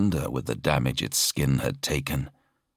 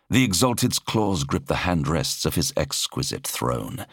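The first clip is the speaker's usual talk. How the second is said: plain reading voice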